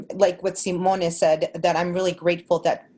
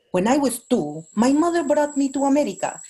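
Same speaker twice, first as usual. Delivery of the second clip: exaggerated heavy Mexican accent, higher pitched; super heavy accent; 7 semitones higher than usual. Shown as a demonstration. Actor showing clients an over-the-top audition read, super heavy Mexican accent. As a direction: lay the Mexican accent on thick. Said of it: "they don't want it super heavy".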